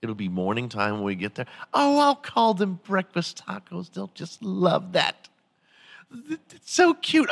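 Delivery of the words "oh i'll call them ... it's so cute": high, delighted ladylike voice, pleased with herself; mock Jill Biden. Jill Biden thinking aloud on the plane, high and pleased with herself. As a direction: coo it like she just had a brilliant idea.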